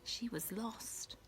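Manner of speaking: slow, soft whisper; hushed whisper. A narrator's reading. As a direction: breathe it, scared. Narration whispered for suspense.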